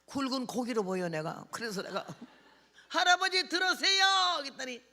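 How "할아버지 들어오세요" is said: high, loud beckoning call. Retold or imitated. Preacher inviting two old men into church, high loud call. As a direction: call old folk in from outside.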